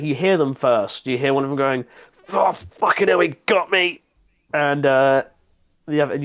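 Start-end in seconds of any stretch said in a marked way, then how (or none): 2.3-4.0 1 wounded guard. Acted.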